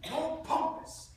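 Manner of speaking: loud, high, drawn-out command; booming coach's correction; calling out pompously. Acted. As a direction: project it slowly at the back rows.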